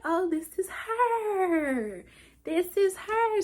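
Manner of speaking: high, drawn-out wail of discovery; mock realization. Retelling how they found the girl's page, high drawn-out mock realization. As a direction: stretch it out high, as if it just clicked. Acts out her moment of discovery.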